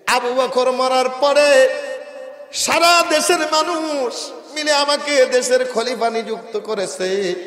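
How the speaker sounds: high, loud sermon chant; preaching at full force. Acted. Preacher voicing the caliph telling his wife, high loud chant.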